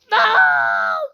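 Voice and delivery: high, loud wail of frustration; desperate high scream; anguished scream. Real, not acted. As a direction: scream as you fall. Falls while in the strange voice.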